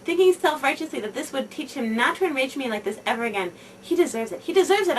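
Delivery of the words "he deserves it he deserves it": the second "deserves" higher and louder, a fierce inner chant; mad inner voice. Retold or imitated. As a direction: repeat it to yourself in a rage, building. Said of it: "I thought madly".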